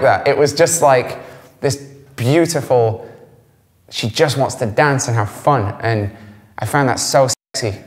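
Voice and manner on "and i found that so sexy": usual speaking voice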